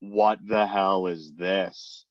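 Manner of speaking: slow, baffled disbelief; mock disbelief. Reading his sister's bland cover letter, slow baffled disbelief. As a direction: drag it out in exasperation. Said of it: "I'm like".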